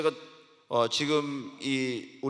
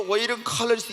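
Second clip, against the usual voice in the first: tongues; 6 semitones higher than usual. Shown as a demonstration. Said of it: "이렇게 하면 뭔 기도하는지 모르잖아요".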